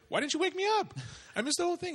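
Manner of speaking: high, playful mock letdown; mock disappointed uncle. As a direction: pretend to be gutted you missed it. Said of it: "I was like".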